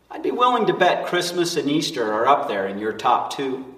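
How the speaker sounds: ordinary talk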